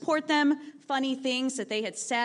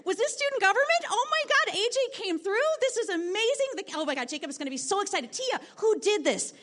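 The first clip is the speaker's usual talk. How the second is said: much higher, rushing burst of thrilled guesses; excited gush; 8 semitones higher than usual. Retold or imitated. Teacher guesses who made the posters, giddy and much higher. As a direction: spill the questions out in a giddy rush. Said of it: "I was over the moon".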